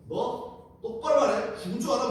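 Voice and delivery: high-pitched acted threat; threatening. Detective interrogating Rahab to frighten her, acted high threat. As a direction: intimidate like a rough interrogator. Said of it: "막 협박을 하지 않습니까?".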